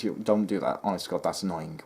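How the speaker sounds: ordinary talk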